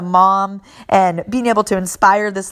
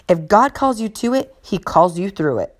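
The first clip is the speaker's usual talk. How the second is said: ordinary delivery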